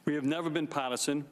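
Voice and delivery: ordinary talk, nothing marked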